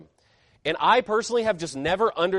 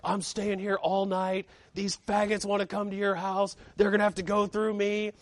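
restrained, softer mock fury with a tense edge; imitating angry friend; 2 semitones higher, 5 dB quieter than usual. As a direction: play a worked-up friend standing guard, without shouting. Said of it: "he's pissed. He's hyperventilating. He's mad".

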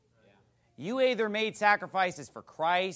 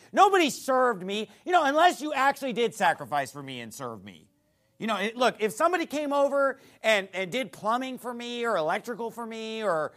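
ordinary talk, nothing marked

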